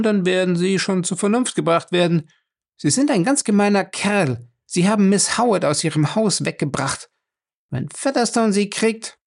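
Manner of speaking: Fay's voice. A narrator's reading. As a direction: put him in his place as Fay. Narrator voices Fay.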